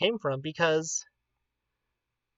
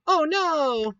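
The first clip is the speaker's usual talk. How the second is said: gasp, then a high dismayed cry; 11 semitones higher, 5 dB louder than usual. Real, not acted. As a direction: caught off guard, alarmed. Realizes the stream broke.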